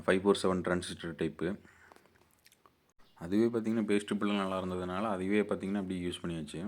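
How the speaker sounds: usual speaking voice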